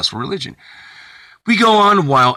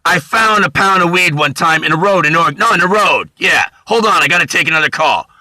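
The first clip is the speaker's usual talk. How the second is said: loud, higher phone shouting; a guy yelling a weed deal down the phone; 6 semitones higher, 4 dB louder than usual. Retold or imitated. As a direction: bark it into a phone. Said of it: "yelling on the phone".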